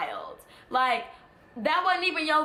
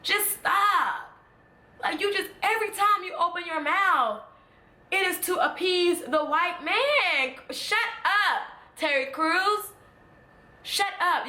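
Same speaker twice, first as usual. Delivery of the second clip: raised fed-up shout at a celebrity; exasperated shouting; 5 semitones higher than usual. Real, not acted. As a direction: tell him to shut up, fed up. Heated rant at Terry Crews.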